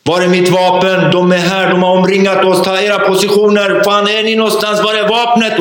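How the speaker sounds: high, panicked battle orders from a bad dream; panicked nightmare shouting. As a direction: relive screaming like a madman for your weapon. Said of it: "skriker som en galning".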